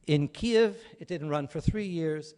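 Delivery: normal talking voice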